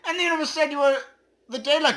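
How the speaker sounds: high, loud, squeaky proclamation; squeaky universe voice. Acted. Voicing the universe to the player as a loud chipmunk.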